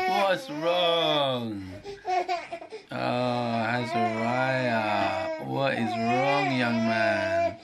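slow high comforting coo. Real, not acted. Dad soothing his frustrated crying son, slow and high. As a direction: comfort him gently.

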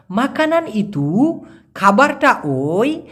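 slow, word by word; slow breakdown. Shown as a demonstration. Teacher glosses the Malay sentence for students, slowly. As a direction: take it apart slowly. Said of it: "slow word-by-word breakdown".

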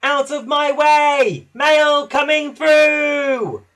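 little engine bellowing like the big express; copying Gordon's slow booming yell; shout as loud as he can. A narrator's reading. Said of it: "He peeped as loud as he could".

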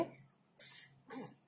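"क्स" as ks with no vowel; phonic X sound. Shown as a demonstration. Teacher showing the sound of X, "क्स" sounded out. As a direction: hiss out each "क्स" separately. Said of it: "एक्स का sound है".

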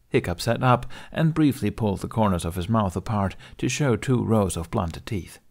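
ordinary delivery

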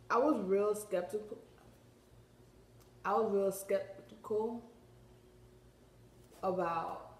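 talking around a retainer; words shaped around a mouth retainer. Real, not acted. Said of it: "I got a retainer in my mouth".

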